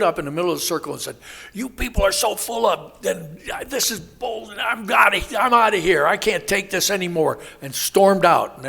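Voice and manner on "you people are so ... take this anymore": angry outburst. Retold or imitated. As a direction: blow up at everyone and storm out. Angry man storms out of meeting.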